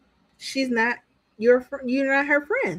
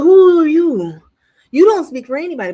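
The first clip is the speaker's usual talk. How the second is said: much louder, higher, fast challenge; indignant shout; 5 semitones higher, 11 dB louder than usual. Retold or imitated. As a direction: demand who she thinks she is. Speaker's own retort, retold.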